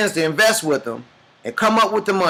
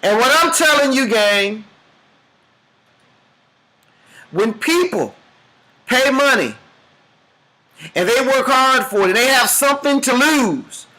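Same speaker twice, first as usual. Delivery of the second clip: rising, higher sermon build-up; preaching climax; 6 semitones higher than usual. Acted. Speaker drives the parable to its peak, preaching. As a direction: push the story's climax like a preacher.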